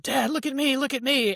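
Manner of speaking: put-on high-pitched kid calling to dad; imitating an excited little boy; excited child calling out. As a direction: call out proudly for dad's attention, high.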